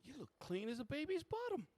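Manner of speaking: very high-pitched character voice; hammed-up high woman's voice; admiring desk woman. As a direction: flatter the guest in a high falsetto. Desk woman appraising the customer.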